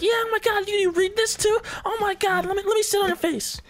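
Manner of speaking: put-on very high, shrieking girly squeal; very high squealing fangirl voice; squealing girls. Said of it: "they're all like".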